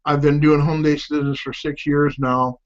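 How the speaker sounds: usual speaking voice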